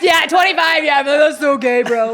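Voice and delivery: dude-bro voice. Retold or imitated. Imitating her younger self.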